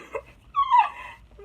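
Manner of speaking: squealing burst of laughter; giggling shriek; high laugh. Real, not acted. Laughs after her mock challenge.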